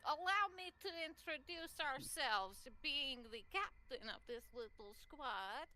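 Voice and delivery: pompous theatrical introduction; grand Vicky. Acted. Captain introducing her squad, slow and grandiose. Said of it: "And she's like".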